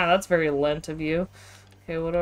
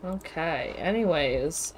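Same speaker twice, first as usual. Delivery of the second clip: ordinary talk, nothing marked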